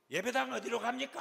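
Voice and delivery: high, loud blunt question; brash demand. Retold or imitated. Woman newcomer walking in late asking the way, brash. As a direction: call it out boldly over the room.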